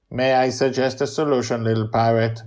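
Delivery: even, formal machine voice; robotic helper voice. Acted. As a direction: speak like a polite robot. Mastermind robot voice.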